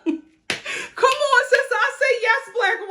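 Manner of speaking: high, loud, laughing hype; laughing cheer. Real, not acted. Vlogger hyping up Paige's new locks, laughing and cheering. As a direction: cheer her on through a laugh.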